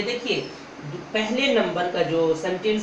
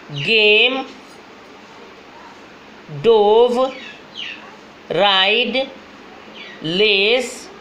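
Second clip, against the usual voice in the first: louder, clear words with pauses for repeating; loud word drill; slower than usual. Shown as a demonstration. Teacher leading students in loud choral reading. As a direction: call out each word for the class to echo. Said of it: "इसको आप लोग साथ साथ पढ़िए".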